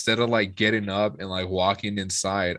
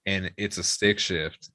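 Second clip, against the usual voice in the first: ordinary talk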